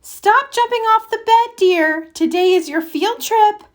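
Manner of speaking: high, gentle mother scolding; mother telling Froggy to stop jumping, high gentle voice; mother's voice. A narrator's reading. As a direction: lightly chide her child.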